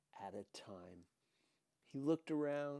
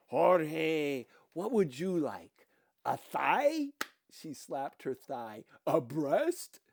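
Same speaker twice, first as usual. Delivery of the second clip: louder, playful bawdy matron; loud flirty matron voice; loud teasing Dika; 3 semitones higher, 11 dB louder, slower than usual.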